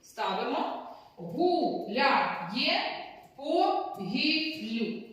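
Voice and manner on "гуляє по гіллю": «Гуляє по гіллю» slowly, syllables drawn out. Shown as a demonstration. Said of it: "Виділю наголошені склади". (повільно по складах)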